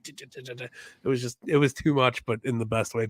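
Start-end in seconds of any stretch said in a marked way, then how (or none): 0.0-0.6 clipped tch tch imitating a stuttering camera; camera stutter noise. Imitating the stuttering workout camera with clicks. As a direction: click out the jerky camera cuts. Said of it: "It's like".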